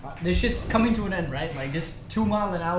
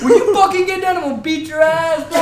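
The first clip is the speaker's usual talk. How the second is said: higher, louder mock threat; imitating angry yell; 10 semitones higher, 7 dB louder than usual. Angry man cursing at the cart riders, mock yell. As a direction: shout it like the furious guy below.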